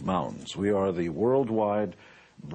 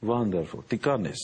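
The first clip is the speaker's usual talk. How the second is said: put-on "Wonderful" as vanderful, a light Hungarian accent; a hint of an immigrant mother's Hungarian accent; Hungarian mom's accent; 2 semitones higher than usual. Mother reacting to the check, light Hungarian accent. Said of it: "in her broken English".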